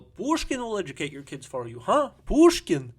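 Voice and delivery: goading, building to a high shout; taunting yell. Acted. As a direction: start taunting, finish yelling it out. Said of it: "fly up to him and yell".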